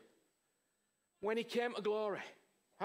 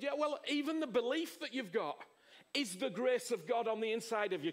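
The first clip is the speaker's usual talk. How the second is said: normal talking voice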